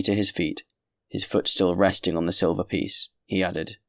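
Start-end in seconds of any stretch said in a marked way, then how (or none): none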